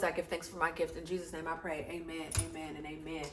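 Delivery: soft, earnest prayer voice; quiet prayer. Acted. Reader praying softly before the tarot reading. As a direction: pray it low and reverent.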